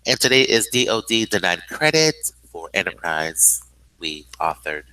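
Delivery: ordinary delivery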